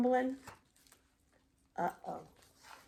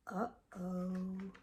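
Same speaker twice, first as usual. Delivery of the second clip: soft gasp; 6 semitones lower, 13 dB quieter than usual. Acted. Crowd freezes in story.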